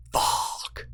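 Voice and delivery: soft whispered curse; mock dismay with no voice in it; hushed dread. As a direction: curse under the breath, retold. Retold dread at sudden stomach rumble.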